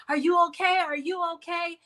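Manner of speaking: high, loud fretful asking; mock-worried people rushing to help; worried fussing. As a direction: mimic anxious friends hovering. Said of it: "people wanna run and be like".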